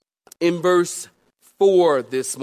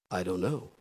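phone voice; 10 semitones lower, 10 dB quieter than usual. Retold or imitated. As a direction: answer quietly like on the phone. Pastor answers in phone voice.

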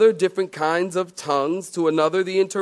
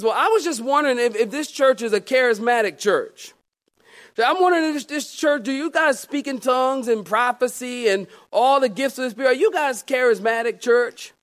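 higher, fast curious woman; lady caller voice; 7 semitones higher than usual. Retold or imitated. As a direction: play the eager lady caller. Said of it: "and she says".

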